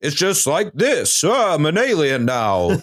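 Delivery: slow, lame alien voice; hammed-up alien, deliberately unimpressive; disappointing alien voice. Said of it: "most hilariously disappointing voice an alien could have".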